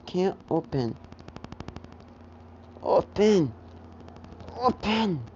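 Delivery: put-on high, rising toddler shouts of open; a little kid straining and yelling at a stuck lid; frustrated toddler. Toddler Midoriya fighting the statue lid, frustrated shout.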